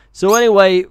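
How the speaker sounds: ordinary talk, nothing marked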